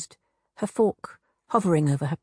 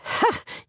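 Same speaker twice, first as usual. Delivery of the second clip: short breathy hag cackle; cackling laugh. A narrator's reading. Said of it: "the hag laughed".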